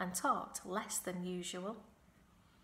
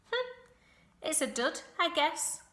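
goose voice; 8 semitones higher, 5 dB louder than usual. A narrator's reading. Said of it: "said the goose".

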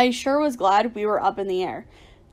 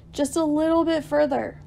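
higher-pitched urgent call; shouted encouragement; 5 semitones higher than usual. A narrator's reading. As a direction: urge a tiring friend on. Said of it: "I shouted".

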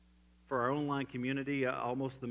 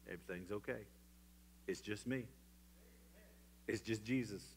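quiet, comforting Jesus; tender reassurance; 2 semitones lower, 9 dB quieter than usual. Acted. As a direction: soothe a frightened friend.

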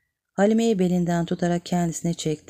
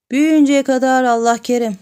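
laughing; 7 semitones higher, 7 dB louder than usual. A narrator's reading. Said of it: "Bu söze kendi de güldü".

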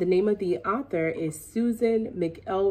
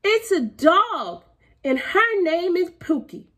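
put-on girl voice; 9 semitones higher, 3 dB louder than usual. A narrator's reading. Girl insisting to papa chicken is dog. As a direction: state it proudly like a stubborn kid.

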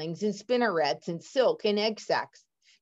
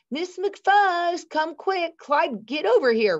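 high, loud call for the teacher to come see; excited yell; 11 semitones higher, 4 dB louder than usual. A narrator's reading. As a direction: yell across the classroom, thrilled. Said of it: "Rosemary yelled".

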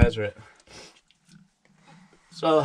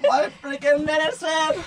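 laughing. Real, not acted. Laughs at his own loss.

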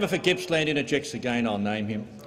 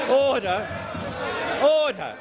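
shouting order order; 8 semitones higher, slower than usual. Real, not acted. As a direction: bark order over the noise. Speaker calling the House to order.

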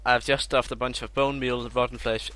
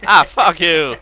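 real startled yell; sudden loud cry of annoyance; loud outburst; 8 semitones higher, 17 dB louder, slower than usual. Real, not acted. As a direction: shout it out, caught off guard. Caught in a trap by a friend.

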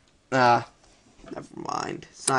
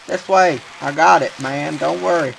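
ordinary talk

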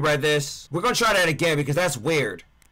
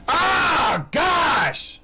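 startled yell; 10 semitones higher than usual. Real, not acted. Startled by monster hearing him.

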